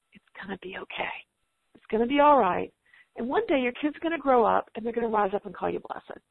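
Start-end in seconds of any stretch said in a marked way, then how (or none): 0.1-1.1 soft whisper, it's gonna be okay; whispered reassurance. Acted. Speaker to a worn-out homeschool mom, soft whisper. 1.7-2.7 louder, firm reassurance on "all"; reassuring. Acted. Speaker to a worn-out homeschool mom, firm reassurance.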